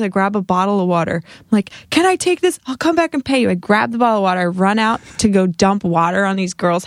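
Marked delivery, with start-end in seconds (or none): 1.9-3.5 high, hurried retold plea; worked-up, pitched-up asking; frantic request. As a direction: act out asking in a mad rush. Retold frantic request mid-rage.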